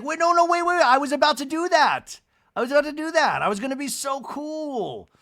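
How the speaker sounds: high, loud, rushed squeal of protest; a mock frantic cry to stop the others; excited protest. [name] retells eager cupcake plan.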